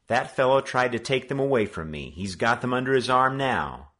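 put-on boy speaking up. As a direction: stand up for what's yours. Joe accuses Brick.

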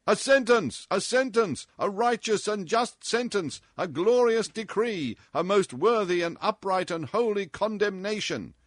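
high, loud cries of approval; shouted acclaim. A narrator's reading. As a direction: cry it out like a cheering court. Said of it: "shouted the Pest family altogether".

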